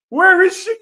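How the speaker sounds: loud exasperated question. Real, not acted. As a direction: call it out, baffled. Calling out for missing wrestler.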